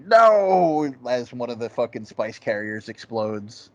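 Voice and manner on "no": high, loud mock villain wail; classic villain cry. Imitating Beast Raban in the desert montage, loud villain cry. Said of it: "letting out one of those classic villain, like".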